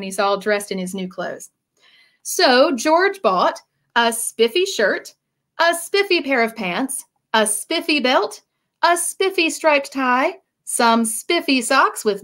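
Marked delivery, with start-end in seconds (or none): none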